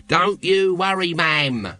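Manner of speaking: cheeky, cheerful volunteer. A narrator's reading. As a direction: chirp it cheerfully, eager to help. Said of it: "volunteered Nobby cheerfully".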